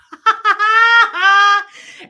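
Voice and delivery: high, loud cackle of laughter. Real, not acted. Host laughing at a movie line, loud burst.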